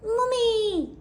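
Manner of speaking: high joyful cry of Mummy; a high tearful call. Acted. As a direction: cry out in relief. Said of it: "cried Gertie".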